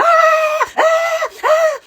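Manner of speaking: high, loud panicked yells; high shrieking mock terror; mock screaming. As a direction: scream like a man trapped with Death at the door. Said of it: "he's in there screaming".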